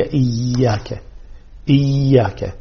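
إياك with a long, held y; doubled ya. Shown as a demonstration. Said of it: "نشدد الياء". (إياك بشدة واضحة)

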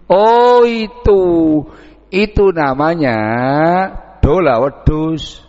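drawn-out, loud, high "Oh itu"; light up as the name finally clicks; villager's eager recognition. Acted. A villager tells the asker the nickname, eagerly.

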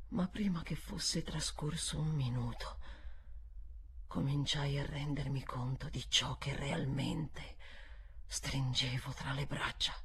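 low, soft, dropping voice; hushed dread. A narrator's reading. Narrator realising what she holds, hushed. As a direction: sink to a hush of horror.

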